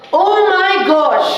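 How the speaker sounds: high, slow, excited mom exclaiming; mom on the sidelines. Retold or imitated. Son imitating his mom at his football game, high and drawn out. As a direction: gasp it out like a worried mother. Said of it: "my mother would be like".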